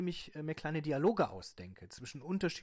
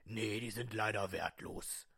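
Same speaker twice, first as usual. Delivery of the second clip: low slow voice; 3 semitones lower, slower than usual. Acted. Appraiser voice.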